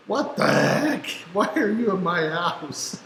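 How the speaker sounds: mock startled cry, high, the second question slow; high-pitched shocked yelp. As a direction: act out kids finding a squatter at night. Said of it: "they're like".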